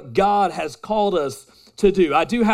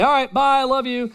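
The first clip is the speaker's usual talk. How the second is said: cheery goodbye; 8 semitones higher than usual. Retold or imitated. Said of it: "'cause I'll say".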